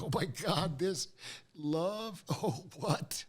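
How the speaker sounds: high, giddy amazement; lovestruck. Retold or imitated. Acts out being lovestruck.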